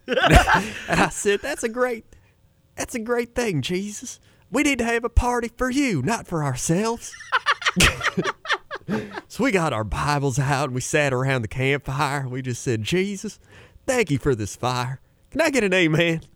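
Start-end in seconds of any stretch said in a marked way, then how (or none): 1.4-7.1 Joel Osteen impression. Mimicking Joel Osteen to a friend, high upbeat preacher voice. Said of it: "He's like". 12.8-16.2 Osteen preacher impression. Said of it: "He's like".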